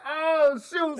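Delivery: loud, high exclamation of surprise; astonished. Retold or imitated. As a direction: cry out at a sudden discovery. Retells spotting his family in audience.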